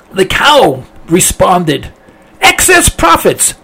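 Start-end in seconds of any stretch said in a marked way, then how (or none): 2.4-3.5 high-pitched mock protest; hammed-up, high-voiced accusation; indignant cow. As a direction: cry out in mock outrage. Cow's outraged reply in fable.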